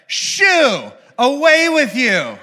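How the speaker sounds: high, loud, slow shoo; shooing shepherd. Retold or imitated. Shepherd shooing away the angel, loud and high. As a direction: wave the angel off, hammed-up. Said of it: "the other guy's like".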